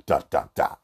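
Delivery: hammed-up three-stage fall noises; mock bad-acting fall. Boyfriend mocking girlfriend's fake fall, hammed-up. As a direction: mimic her fake collapse, corny. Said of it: "in a very bad acting way".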